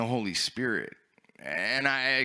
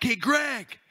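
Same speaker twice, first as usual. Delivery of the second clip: calling out; 11 semitones higher than usual. Retold or imitated. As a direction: get his attention, mocking. Coworkers calling out to him.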